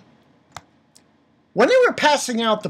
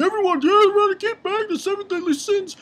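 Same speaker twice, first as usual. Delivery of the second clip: much higher, squeaky mock command; mock Holy Knight; 13 semitones higher, faster than usual. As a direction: parody the knight rallying the crowd. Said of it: "the Holy Knight guy saying".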